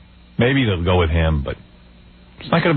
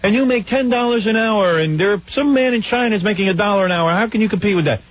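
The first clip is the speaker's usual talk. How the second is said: Buchanan stumping; 11 semitones higher than usual. Retold or imitated. Host mimics candidate rousing factory workers, mock stumping. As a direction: put on a high-pitched rabble-rousing candidate. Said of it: "he's like, you know".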